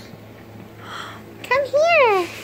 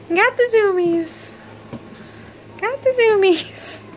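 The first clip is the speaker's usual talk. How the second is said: high, louder sing-song pet talk; baby talk to a pet; 3 dB louder than usual. Acted. Owner cheering the running puppy, high sing-song baby talk. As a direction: bounce along with the playful puppy.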